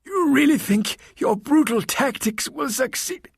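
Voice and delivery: very high, slow strained croak; aged nun defiant, high and drawn out; croaking old nun. A narrator's reading. Half-strangled nun challenging her attacker, high croak. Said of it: "she croaked".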